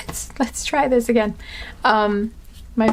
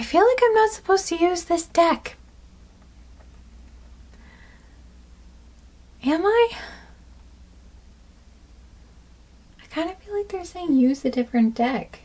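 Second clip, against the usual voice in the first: questioning spirits; 9 semitones higher than usual. Real, not acted. Reader consulting her spirit guides.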